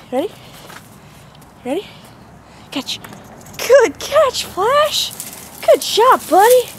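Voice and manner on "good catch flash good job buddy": high, loud squeaky praise; squeaky praising a dog. Real, not acted. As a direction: squeal with delight at the pup. High praise after catch.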